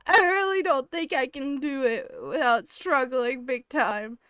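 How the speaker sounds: laughing. Real, not acted. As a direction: says it through real laughter.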